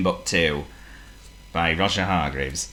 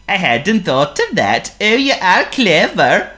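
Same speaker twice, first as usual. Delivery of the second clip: Honk the seal; 13 semitones higher, 7 dB louder than usual. Acted. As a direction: play a polite silly seal. Honk's voice.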